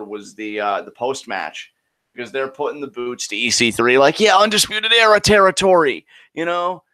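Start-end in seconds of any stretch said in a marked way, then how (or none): 4.2-6.8 high, loud gang taunt; mock boasting. Imitating the faction kicking a downed rival, mock boasting. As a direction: crow it like a victorious gang. Voicing wrestlers claiming their territory.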